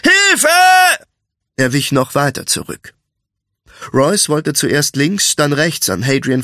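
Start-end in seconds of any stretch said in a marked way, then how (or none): 0.0-0.5 very high, loud shout; cry for help. A narrator's reading. Butcher calling for rescue from the thief, high panicked shout. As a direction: scream for someone to save you. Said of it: "rief der Fleischer".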